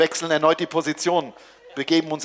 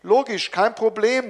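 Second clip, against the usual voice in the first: called out; 7 semitones higher, 4 dB louder than usual. Acted. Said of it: "rufe ich".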